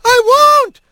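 very high, loud screamed defiance; screaming. A narrator's reading. Otho refusing the sergeant's order, screaming. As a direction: scream it at full pitch. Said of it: "Otho screamed".

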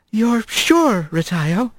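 worried. Acted. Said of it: "worry in her voice".

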